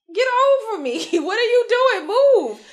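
loud laughing yell, high and loud; retold yell at kid. Woman yelling at a kid snuggled on her chest, laughing. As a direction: shout at the kid while laughing.

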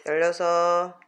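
ordinary talk, nothing marked

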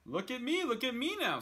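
high, loud showman cry; showing off. Acted. Cat shows off to the kids, high and loud. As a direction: crow for attention. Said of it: "said the cat".

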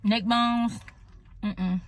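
talking between bites; muffled chewing speech; mouth full chewing. Real, not acted. Seen 1: Fork-fed bite at -2.0, lips closed mid-chew through 2.3.

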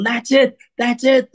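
high, thrilled exclamation, imitated; retold gleeful cheer, high but not shouted; imitating excited delight. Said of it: "he started bouncing up and down".

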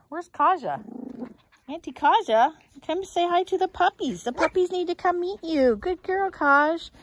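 high, loud sing-song call; calling the dog. Real, not acted. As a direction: call her over up high and bright. Calling the dog Kaja over.

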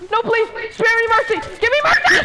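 high, loud mock plea for mercy; hammed-up begging. Son begging his dad for mercy in game, hammed-up loud plea. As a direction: overplay a captive begging to be spared.

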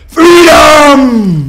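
long, high, full-force battle cry; huge Scottish rallying yell; William Wallace scream. Acted. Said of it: "can you please scream, 'Scotland, freedom'".

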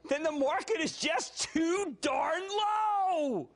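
high, drawn-out cry; emphatic holler. Real, not acted. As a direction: stretch it out slowly into a high holler. Host's punchline in his rant.

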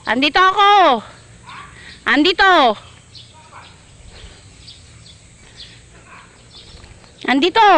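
loud high call across the resort. Real, not acted. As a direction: shout to be found from afar. Calling out to Bernard.

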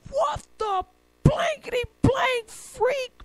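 high, slow, outraged mock yell; cop yelling. Cop yelling at the dude in traffic, censored mock fury. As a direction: draw out each word in high-pitched outrage. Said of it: "he yells at the dude".

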